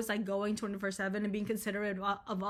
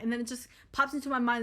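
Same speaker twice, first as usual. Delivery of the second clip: ordinary talk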